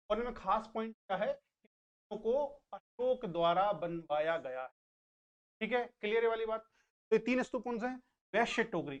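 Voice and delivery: normal talking voice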